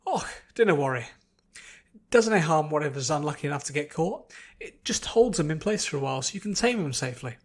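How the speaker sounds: put-on a light Scottish dwarf accent, reassuring and cheerful. Dwarf reassuring Fluttershy about the trap, light Scottish accent. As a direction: brush off her worry in a Scottish burr.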